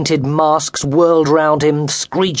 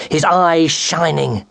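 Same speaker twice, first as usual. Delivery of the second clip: usual speaking voice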